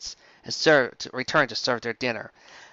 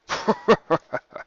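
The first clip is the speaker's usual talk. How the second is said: a hearty dragon chuckle, a little higher; 6 semitones higher than usual. Acted. Smog amused by Rainbow's story, hearty chuckle. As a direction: chuckle warmly at the misunderstanding. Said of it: "Smog let out a hearty chuckle".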